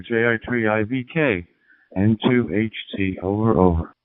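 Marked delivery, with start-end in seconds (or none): none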